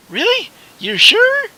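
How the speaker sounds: put-on high, quavery old woman; old lady voice. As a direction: play a sweet old shop lady. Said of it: "how you like my old lady voice".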